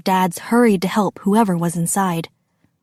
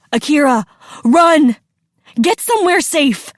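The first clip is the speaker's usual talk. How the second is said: rising, urgent shouted order; yell; 4 semitones higher, 4 dB louder than usual. A narrator's reading. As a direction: yell a warning to your child. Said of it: "her dad yelled".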